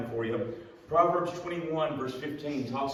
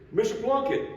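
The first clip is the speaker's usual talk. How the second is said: loud, high call across a distance; 10 semitones higher, 4 dB louder than usual. Retold or imitated. Davis calling out to Dad as he walks away, loud high call. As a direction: shout his name to get his attention.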